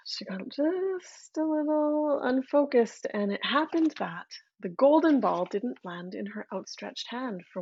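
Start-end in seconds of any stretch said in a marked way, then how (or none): 0.1-3.1 breathy hushed whisper, slowing down; storyteller's whisper. A narrator's reading. Narrator whispering to listeners as the ball slips. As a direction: lean in and whisper the slip.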